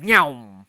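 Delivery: loud "nyoom" whoosh of a lunging creature; sudden loud imitated screech; imitated creature shriek. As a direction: mimic it shrieking up at you, one loud burst. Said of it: "It shrieks up at me".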